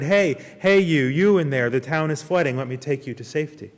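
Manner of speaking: loud call across the water; shouting. Acted. Rowboat rescuer shouting to the stranded man, loud call. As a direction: call out to him loudly. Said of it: "he shouted".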